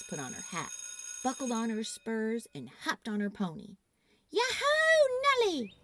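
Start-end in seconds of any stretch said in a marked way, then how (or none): 4.3-5.7 high, loud whoop on yahoo; shouted yahoo. A narrator's reading. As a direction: whoop it out like a rodeo rider. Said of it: "she shouted".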